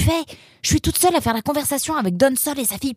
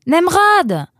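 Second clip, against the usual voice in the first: loud, high shout of a name; calling out; 5 semitones higher, 6 dB louder than usual. Acted. Roxane shouting to Nemrod from the bathroom. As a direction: holler through the house. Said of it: "cria-t-elle depuis la salle de bain".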